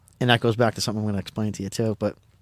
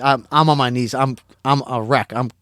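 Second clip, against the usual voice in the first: ordinary talk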